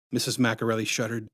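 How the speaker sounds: ordinary delivery, nothing marked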